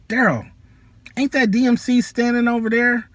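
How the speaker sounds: high-pitched excited tip-off; excited spotting. Retold or imitated. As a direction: point him out in a rush. Friend spotting [name].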